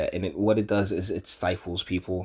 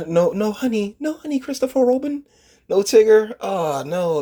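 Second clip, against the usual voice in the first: much higher, squeaky put-on voice; high cartoon voice; 12 semitones higher, 4 dB louder than usual. Voicing a character about Pooh.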